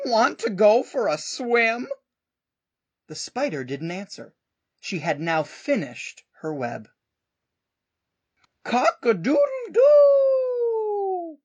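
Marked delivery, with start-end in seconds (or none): 0.1-1.9 duck voice. Acted. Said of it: "called the duck". 8.6-11.4 very high, loud, slow crow; crowing proud rooster. Acted. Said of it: "crowed the rooster".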